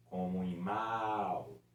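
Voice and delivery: "mal" very slow; slow example. Shown as a demonstration. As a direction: draw out mal. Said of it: "Ou podia ser o U". ("mal" bem devagar)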